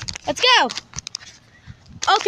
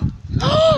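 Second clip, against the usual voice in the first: excited gasp; 10 semitones higher than usual. Real, not acted. Spots a puppy.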